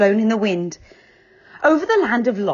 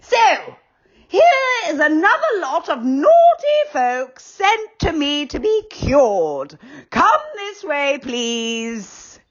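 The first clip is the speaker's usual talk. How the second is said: put-on higher, gloating old schoolmistress; sly Dame Snap; 7 semitones higher, slower than usual. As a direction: relish catching the naughty ones. Dame Snap greets the children.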